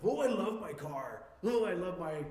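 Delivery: hammed-up gushing over a car. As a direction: coo over your car. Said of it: "we're conditioned already to say this stuff".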